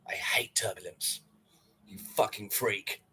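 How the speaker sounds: soft unvoiced whisper; whispered aside. Real, not acted. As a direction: hiss it under your breath. Muttered aside.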